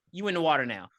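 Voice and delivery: ordinary talk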